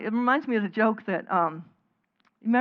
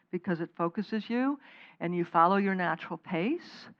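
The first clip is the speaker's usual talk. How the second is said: normal talking voice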